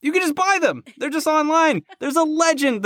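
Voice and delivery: high, incredulous enthusiasm; excited. Real, not acted. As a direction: marvel that it's so easy.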